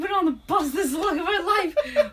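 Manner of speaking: mock outrage broken by laughter; laughing imitation. Friend teasing him about the long bus ride, laughing.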